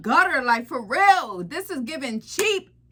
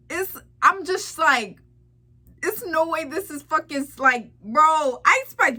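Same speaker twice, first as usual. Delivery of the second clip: normal talking voice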